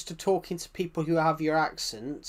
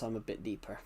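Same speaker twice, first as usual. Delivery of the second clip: lower, softer natural speaking voice; real deeper voice; 6 semitones lower, 11 dB quieter, faster than usual. Shown as a demonstration. Streamer shows chat his real deeper voice. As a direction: drop into your everyday low voice. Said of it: "if I was to actually talk to you like this".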